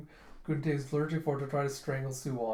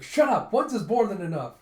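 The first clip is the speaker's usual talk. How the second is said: high, loud shouted scolding; angry yell; 7 semitones higher, 9 dB louder than usual. Retold or imitated. Judo telling the shouting general to shut up. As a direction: snap at him to stop. Judo yells at excited Gunte.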